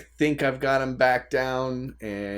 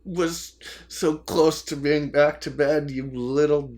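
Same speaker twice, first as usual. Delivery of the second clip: mock exasperation turning tender; 4 semitones higher than usual. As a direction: scold the baby then melt. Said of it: "there are those tense moments where he's like".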